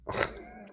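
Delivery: a high squeaky laugh; incredulous laugh. Real, not acted. Laughing at the absurdity, a high squeak. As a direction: burst into a disbelieving laugh.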